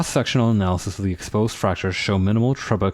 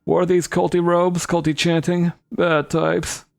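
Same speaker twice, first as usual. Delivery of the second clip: put-on high, rambling old goblin; pitched-up old-man storytelling; old guy voice; 10 semitones higher than usual. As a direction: recount it as a crotchety old-timer. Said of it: "I'm gonna give him the old guy voice".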